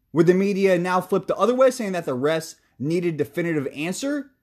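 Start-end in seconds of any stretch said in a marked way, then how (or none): none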